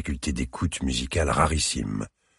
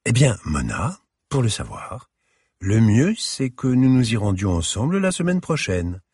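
put-on elegant older lady; 8 semitones higher than usual. A narrator's reading. Curator answers the child.

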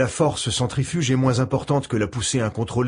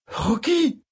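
shouting; 13 semitones higher than usual. Acted. Friend collapses.

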